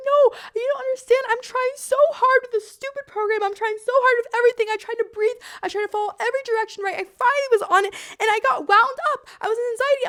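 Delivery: high, loud acted crying rant; losing it. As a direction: re-enact a frantic meltdown, wailing in protest. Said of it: "and I lose it on her".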